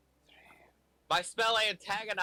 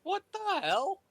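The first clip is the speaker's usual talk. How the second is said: surprised; slower than usual. Real, not acted. Teammate's power wasted by Darkness.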